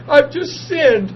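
slow, tearful confession; crying. Retold or imitated. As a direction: say it weeping, drawn out. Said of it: "and I'm crying".

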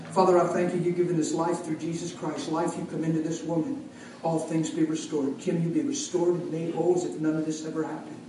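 soft, reverent prayer; quiet prayer. Retold or imitated. Retold healing prayer.

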